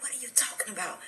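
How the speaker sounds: faint, tear-choked question. Retold or imitated. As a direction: barely get the words out through crying. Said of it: "when you're crying so much".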